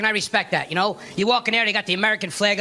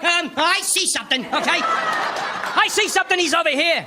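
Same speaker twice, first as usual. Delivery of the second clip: higher, dragged-out alarm call; slow high mock alarm; 5 semitones higher, slower than usual. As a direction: raise the alert across the room. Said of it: "I'm like".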